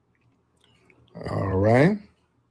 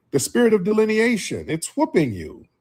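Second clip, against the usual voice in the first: ordinary talk, nothing marked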